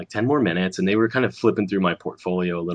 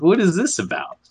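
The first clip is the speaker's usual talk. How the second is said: puzzled; 10 semitones higher than usual. Retold or imitated. Retold confusion at offer.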